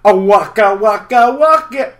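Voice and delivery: the improved bear take; new Fozzie waka. Shown as a demonstration. Fozzie's catchphrase, new version. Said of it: "But the new one's".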